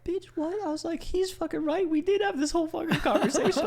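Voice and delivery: very high falsetto outrage; retold high-pitched protest; incredulous squeal. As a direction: squeak it out in mock disbelief. Retold incredulous reaction to mom.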